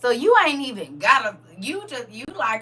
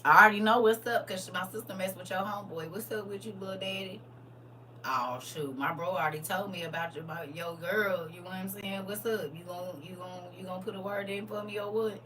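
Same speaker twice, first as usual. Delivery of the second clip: low hushed street voice; 6 semitones lower, 10 dB quieter than usual. Acted.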